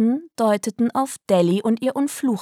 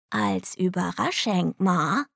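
soft, slow correction; correcting; 6 dB quieter, slower than usual. A narrator's reading. Delly setting her Ma straight, soft slow correction. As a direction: quietly put it right, drawn out. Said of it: "stellte Delly richtig".

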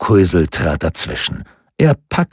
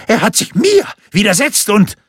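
high, spluttering complaint; outraged, high-pitched official; indignant scolding; 12 semitones higher than usual. A narrator's reading. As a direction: stammer at first, then rant. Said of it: "schimpfte der Amtsdiener".

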